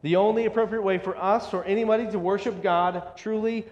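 ordinary talk

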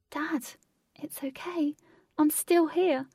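high, fragile plea to be heard; pained reassurance. Acted. Katie calls to her unhearing father, pained. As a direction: reach out to him in a high voice.

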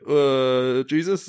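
a lame half-guess, lightly imitated; unsure answer. Caught out at the door, hesitant. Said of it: "I'm like".

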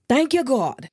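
high, heartfelt cry of thanks; overwhelmed gratitude. Retold or imitated. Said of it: "brought tears to my eyes".

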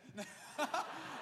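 high breathy laugh. Real, not acted. Preacher laughing at his own joke, laughing. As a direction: burst out laughing.

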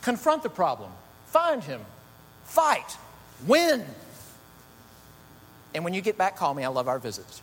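clipped commands building up; Edna Mode. Retold or imitated. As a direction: Edna ordering Elastigirl into action, brisk. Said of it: "Then she says".

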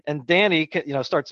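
ordinary delivery

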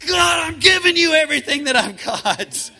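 an earnest shouted prayer, holding nothing back; all-out cry to God. Pretend. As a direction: throw everything into it. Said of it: "No, it's like".

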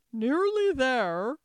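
loud, slow echo of a question; incredulous echo. A narrator's reading. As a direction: repeat it slowly and loudly, as if astonished. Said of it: "the queen repeated".

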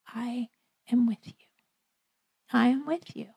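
quiet reassurance. Acted. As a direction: say it gently, like a steady promise. God's steady answer voiced.

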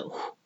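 "wh-" as a cut-off breathy onset; wh sound. Shown as a demonstration. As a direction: sound out just the start "wh-". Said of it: "the w is silent".

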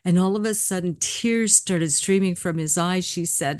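normal talking voice

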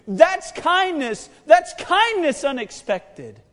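loud, high, slow hammered phrases; preaching at full force. Real, not acted. Preacher telling church Jesus died for them, full-force preaching. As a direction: drive each phrase home from the pulpit.

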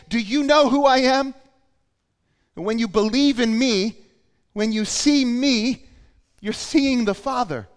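high, loud challenge to the crowd; Jesus crying out. Retold or imitated. Preacher voicing Jesus' claim about the Father, shouting. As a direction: proclaim it forcefully, high and loud. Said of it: "He shouted".

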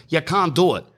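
high-pitched pub man laying down the rules; acted-out protest, pitched well up; exasperated refusal.

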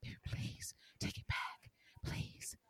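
soft breathy pleading whisper; whispered begging. Retold or imitated. Spencer begging Trina to take him back, whispered plea. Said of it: "He was begging".

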